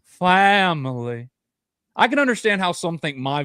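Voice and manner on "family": slow, drawn-out mock of the coach's grating voice; Brian Kelly catchphrase. Podcast host mocks Brian Kelly's catchphrase for his cohost, slow drawn-out imitation. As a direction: stretch "Family" out lazily like Brian Kelly. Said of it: "his voice sounds... it's just grating".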